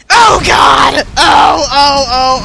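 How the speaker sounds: loud, high cry of alarm; shocked yelp. Pretend. Player accidentally hits the dog, shocked shouting. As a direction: yell out in horror, then ease slightly. Petting the dog goes wrong.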